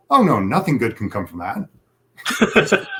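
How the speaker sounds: slow, amused quote broken by laughter; slow amused laughing quote; laughing through the line. Retold or imitated. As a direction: chuckle through the movie line. Retelling George Clooney's movie line.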